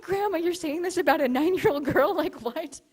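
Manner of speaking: high, loud, laughing incredulity; half-laughing, can't believe grandma said that; laughing disbelief. Real, not acted. Telling friends about grandma's answer, laughing loud disbelief.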